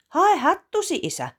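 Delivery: high, loud eager request; girl urges father to fetch his hat, high and eager; eager child. Acted. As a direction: hurry him along.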